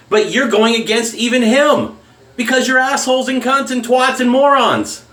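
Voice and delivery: angry rant. Real, not acted. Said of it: "I'm actually angry about this one".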